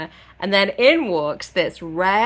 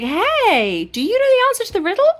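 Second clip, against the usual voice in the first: much higher and fast, fake-innocent; sly sweet asking; 7 dB louder than usual. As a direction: bat your lashes and ask sweetly. Feyre slyly fishes for answer.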